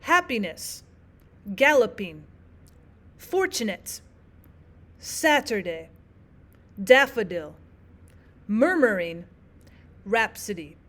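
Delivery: "Happiness", "galloping", "fortunate", "Saturday", "daffodil", "murmuring", "rhapsody" each stressed on the first syllable, two light after; spaced list from "Happiness" to "rhapsody", hitting each first syllable and falling away; dactylic stress. Shown as a demonstration. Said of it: "one stressed syllable, and then it's followed by two unstressed".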